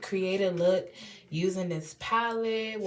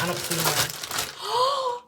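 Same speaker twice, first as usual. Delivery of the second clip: mock whine; 12 semitones higher, much slower than usual. Real, not acted. Mock protest about her accent.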